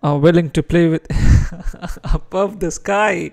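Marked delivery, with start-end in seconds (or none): 1.1-2.1 loud burst of laughter; amused laugh. Real, not acted. Streamer amused by a viewer's username, amused laugh. 2.1-3.3 high, slow and amused; laughing. Real, not acted. Streamer reading a viewer's funny username, laughing.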